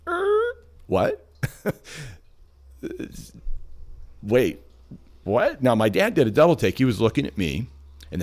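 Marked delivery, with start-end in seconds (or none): 0.1-5.6 high, cracking "what?" of total surprise; stunned disbelief. Retold or imitated. Stunned teen, record scratch moment.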